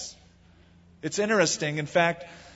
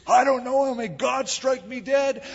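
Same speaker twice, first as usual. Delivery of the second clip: vehement denial; 9 semitones higher than usual. Acted. Peter cursing and denying he knows Jesus, vehement. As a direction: swear it hard in a raised, high voice. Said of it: "he's cursing and swearing".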